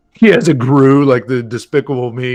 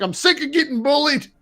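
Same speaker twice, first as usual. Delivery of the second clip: whiny villain voice; 11 semitones higher than usual. Retold or imitated. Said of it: "He was like".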